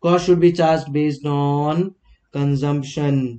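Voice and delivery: very slow, every word spaced for writing down; slow dictation. Shown as a demonstration. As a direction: dictate it slowly while writing on the board. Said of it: "अच्छी handwriting में लिख देता हूँ".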